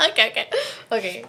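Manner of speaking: ordinary talk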